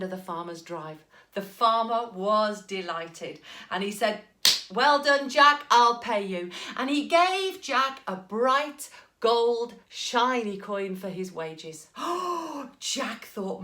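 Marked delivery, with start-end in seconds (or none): 4.7-6.5 hearty farmer praise. Acted. 11.9-12.8 a sharp, high gasp of delight. Acted. Jack gasping at his shiny gold coin.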